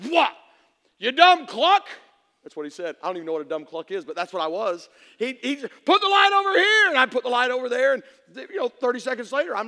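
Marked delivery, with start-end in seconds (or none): 0.1-1.9 loud, higher scolding; a hammed-up furious father snapping at his son; mock angry dad. 5.8-6.9 loud, higher command; a bossy father barking instructions; mock dad order.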